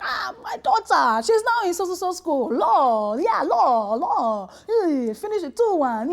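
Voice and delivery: excited dad bragging, high swinging pitch; sing-song proud father, words drawn out; boastful dad. Acted. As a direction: gush proudly over your daughter. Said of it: "that daddy was like".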